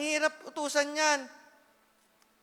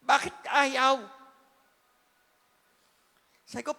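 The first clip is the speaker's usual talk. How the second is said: ordinary talk, nothing marked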